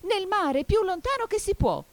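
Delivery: very high, loud cry; excited shriek. A narrator's reading. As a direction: shriek out the dance instructions. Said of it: "strillò il grifone".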